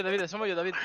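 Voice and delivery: ordinary talk, nothing marked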